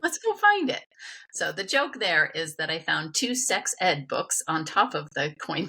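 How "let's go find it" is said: very high, playful echo of Dad; re-enacted keen reply, pitched way up; eager agreement. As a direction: chirp it back, game for the hunt. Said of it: "And I was like".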